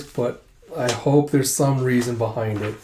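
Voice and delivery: normal talking voice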